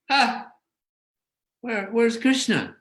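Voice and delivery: gasp, then ask where he went in a high startled voice. Retold or imitated. Gopi suddenly seeing Krishna is gone, startled and high.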